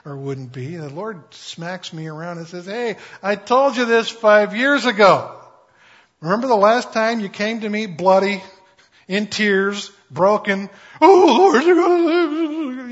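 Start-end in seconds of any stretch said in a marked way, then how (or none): 2.7-10.7 loud, reproachful reminder; the Lord scolding a forgetful believer, loudly. Acted. 11.0-12.9 high, loud mock wail, slowed; imitating his own past tearful begging, high and loud. Preacher mimics his past self wailing.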